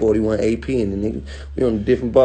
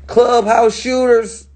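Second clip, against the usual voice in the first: mocking; 12 semitones higher, 5 dB louder, much slower than usual. Real, not acted. Mocking exclamation, drawn out.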